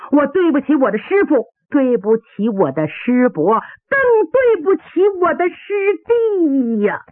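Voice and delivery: rising wail of apology; anguished lament. Acted. Dying fighter apologising to master and junior brother, anguished lament.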